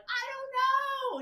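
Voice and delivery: very high, drawn-out whine; mock helpless whine. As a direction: mimic her clueless excuse slowly, high up. Mimics the helpless diabetic woman.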